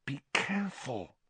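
a soft sharp hiss; hissed warning. Acted. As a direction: warn him softly so the teacher won't wake. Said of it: "hissed Hermione".

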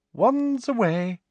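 high, slow, sugary order; sweet command. Acted. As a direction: smile while giving orders. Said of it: "she instructed them all with a smile".

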